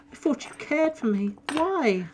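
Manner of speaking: high, wounded plea; a betrayed nymph asking why; high hurt nymph voice. Acted.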